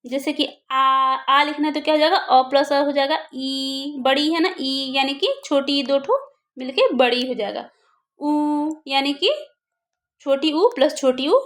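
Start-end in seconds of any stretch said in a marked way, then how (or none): 0.7-0.8 आ held long; sustained आ, demonstrating length; long vowel. Shown as a demonstration. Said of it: "थोड़ा सा जोर लगा के लंबा बोलते हैं". 3.3-4.0 ई drawn out slowly; stretched ई to show the long vowel. Shown as a demonstration. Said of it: "थोड़ा सा जोर लगा के लंबा बोलते हैं". 8.2-8.7 ऊ held long; sustained ऊ, demonstrating length; long vowel. Shown as a demonstration. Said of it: "थोड़ा सा जोर लगा के लंबा बोलते हैं".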